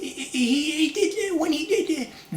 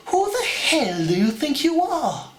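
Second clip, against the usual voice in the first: much higher, slow indignant cartoon voice; Stewie Griffin; 9 semitones higher than usual. Stewie snapping at Brian, high and indignant. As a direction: spit it out high and outraged. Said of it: "do Stewie Griffin from Family Guy".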